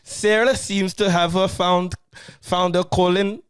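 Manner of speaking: slow, high, loud stab at Scouse; attempted Scouse accent. Host restarting the film synopsis, attempted Scouse accent. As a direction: read it carefully in a badly faked Scouse accent. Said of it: "That's a Scouse accent".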